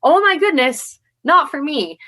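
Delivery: high-pitched, playful disbelief; mock appalled. Newcomer's first thought about armored fighters, mock appalled. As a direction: exclaim it as a scandalised first reaction.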